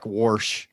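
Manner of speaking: "warsh" with an r put in before the sh; regional "warsh". Shown as a demonstration. Recalling the lost accent, "warsh" with an added r. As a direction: say wash as "warsh", r clearly sounded. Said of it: "Little things that I would say".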